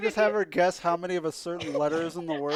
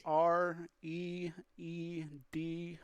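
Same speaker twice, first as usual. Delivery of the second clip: slow, letter by letter spelling; spelled out slowly; 3 dB quieter, much slower than usual. Shown as a demonstration. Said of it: "If you feel the need".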